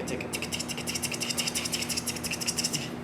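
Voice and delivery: rapid whispered ticka ticka; whispered ticka chatter. Shown as a demonstration. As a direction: rattle off ticka under your breath. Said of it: "just saying ticka".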